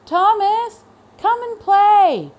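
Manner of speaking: high, loud call from the playground; calling across the yard; far-off yell. A narrator's reading. A child in the playground calls Thomas out loud. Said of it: "someone yelled".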